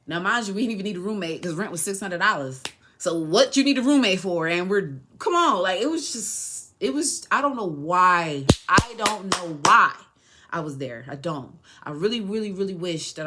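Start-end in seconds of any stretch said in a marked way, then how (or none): 3.0-9.9 exasperated. Real, not acted. Frustrated rant about needless roommate.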